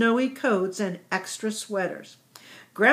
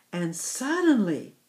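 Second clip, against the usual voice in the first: usual speaking voice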